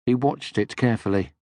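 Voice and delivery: plain reading voice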